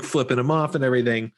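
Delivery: normal talking voice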